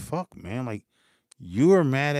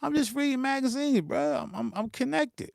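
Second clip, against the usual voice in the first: mock innocent reply; 9 semitones higher than usual. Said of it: "I'm like".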